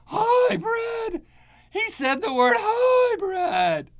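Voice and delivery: high, loud, hammed-up alarm at one word; mock outraged shout. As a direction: shriek it like a fire alarm going off.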